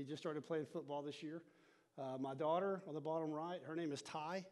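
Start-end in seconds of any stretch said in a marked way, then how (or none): none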